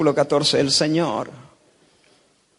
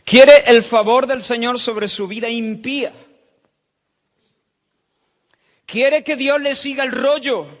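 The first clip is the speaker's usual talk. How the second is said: rising rebuke; 7 semitones higher, 4 dB louder than usual. Real, not acted. Preacher rebukes the hypocrite.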